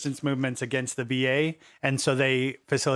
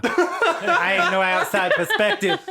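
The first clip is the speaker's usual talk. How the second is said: laughing; 8 semitones higher, 4 dB louder than usual. Real, not acted. Laughing indignant protest.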